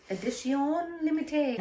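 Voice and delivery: French phrase. Shown as a demonstration. Showing off the French name of the drink, French accent. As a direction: say it with French flair. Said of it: "French, you know".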